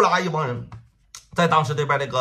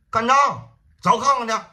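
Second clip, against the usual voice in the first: higher and louder, urgent call to go; urgent raised shout; alarmed call; 10 semitones higher, 6 dB louder than usual. Acted. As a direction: jump up and call the men out. Boss hears of a fight.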